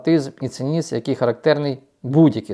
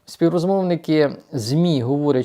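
ordinary talk

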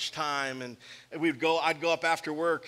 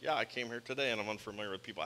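low, quick, softer muttered aside; shy mumble; 7 semitones lower, 7 dB quieter, much faster than usual. Retold or imitated. Voicing shy newcomer.

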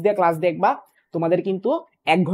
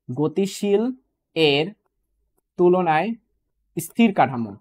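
long pauses between গতিশীল, এর and তুলনায়; word-by-word emphasis; much slower than usual. Shown as a demonstration. Teacher showing reversed exam wording, slow and separated. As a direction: break it apart slowly, one word at a time. Same phrase broken up for students.

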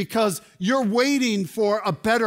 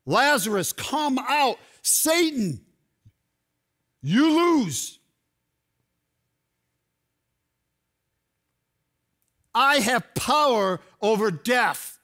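commanding shout; 5 semitones higher than usual. Acted. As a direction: shout down Satan in triumph. Reserve this yell ... straight to death.

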